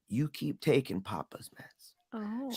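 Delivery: slow, hushed whisper imitating the doctor; confidential whisper. Doctor looks at the girl and approves, whispered. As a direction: whisper it like a secret go-ahead.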